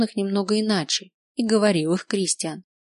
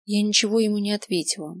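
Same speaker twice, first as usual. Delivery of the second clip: ordinary delivery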